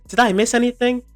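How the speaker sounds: high, loud mock-clueless voice; clueless Kai. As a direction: play it dumb and late. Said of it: "he's like".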